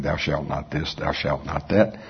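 low, soft, slow reading of commandments; Jesus writing the law. Retold or imitated. Jesus writing on the ground, lists commandments softly. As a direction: murmur the laws quietly. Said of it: "I personally think he starts writing".

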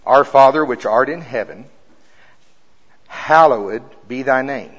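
prayer reading. Acted. Reads the Lord's Prayer.

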